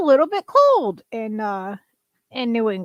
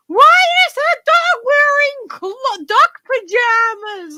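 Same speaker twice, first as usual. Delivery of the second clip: put-on much higher, slower, loud whiny child voice; little kid; 11 semitones higher, 7 dB louder, slower than usual. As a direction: imitate a confused child calling mommy. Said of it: "the other brother started acting up".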